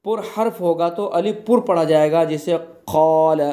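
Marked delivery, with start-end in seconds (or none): 2.6-3.4 क़ाल said slowly with a full, heavy alif; slow Quranic क़ाल. Shown as a demonstration. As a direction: draw out क़ाल full-mouthed. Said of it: "किस तरह पढ़ेंगे?".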